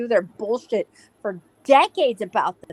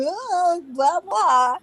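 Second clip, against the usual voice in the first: mocking babble; 6 semitones higher, much slower than usual. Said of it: "you're going".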